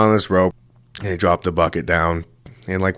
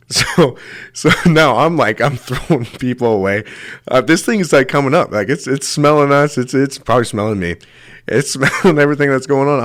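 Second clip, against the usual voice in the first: high, giggly retelling of panic; laughing while telling; 6 semitones higher than usual. Real, not acted. Telling friends of a shark panic, laughing through it. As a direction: let the laughs break into the words.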